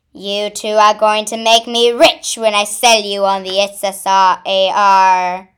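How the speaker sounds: loud hunter's announcement; gloating hunter. Acted. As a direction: boast loudly over your catch. Hunter gloats over captured sisters.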